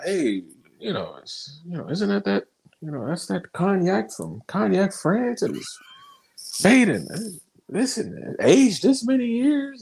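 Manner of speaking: high, put-on polite interviewee voice; code-switched interview voice. Butler candidate talking cognac to his interviewer, high code-switched voice. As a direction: mimic someone code-switching, pitched well up.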